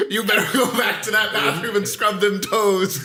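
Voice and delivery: high, loud playful scolding; mock scolding. Host jokingly scolding listeners about feet, loud mock scold.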